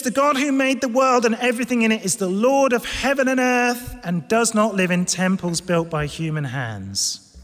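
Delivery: loud, ringing proclamation; Paul. Acted. Preacher reads Paul's words to Athens, forceful proclamation. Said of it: "He says".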